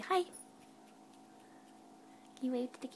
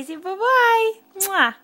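higher and louder sing-song prompt; cheery loud goodbye; 5 semitones higher, 10 dB louder, slower than usual. Real, not acted. Mom cheering toddler to say bye.

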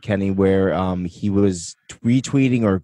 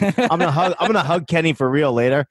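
laughing; 6 semitones higher than usual. Real, not acted. Laughing through excited line.

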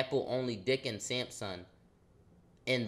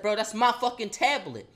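higher, louder angry claim; confronting the classmate, loud and angry; angry confrontation; 8 semitones higher, 6 dB louder than usual. Retold or imitated. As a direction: insist it's yours, heated. Confronting classmate over tablet.

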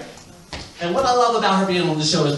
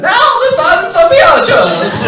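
slow, grand English announcement, much higher and louder; Fergie announcing; 12 semitones higher, 14 dB louder, slower than usual. Retold or imitated. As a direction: proudly present the grandsons in a posh English accent. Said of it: "Fergie has to introduce them".

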